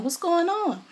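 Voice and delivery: high-pitched puzzled question; retold baffled reaction; bewildered. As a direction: wonder aloud, pitch shooting up. Retells her bewildered thought.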